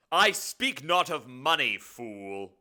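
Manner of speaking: loud, high, slow demon rebuke; scornful demon. Acted. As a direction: thunder it, dropping on the final insult. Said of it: "This will be the demon".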